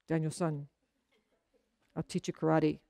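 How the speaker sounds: put-on hushed, low old karate master; Mister Miyagi impression. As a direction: drop low and soft as the wise old teacher. Mister Miyagi voiced in retelling.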